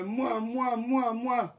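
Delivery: four smacking air kisses; blown kisses. Acted. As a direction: blow kisses at the fans. Blowing kisses to the mermaids.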